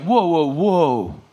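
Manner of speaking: slow, drawn-out whoa, whoa, whoa to calm God down; Moses pleading. Retold or imitated. Moses slowly calming an angry God, drawn out. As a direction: stretch each whoa out like holding someone back. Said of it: "Moses is like".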